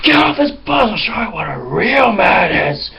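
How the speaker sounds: hammed-up confrontation; angry man on the bus. The man getting off confronts the pranksters, mock angry. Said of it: "he went like".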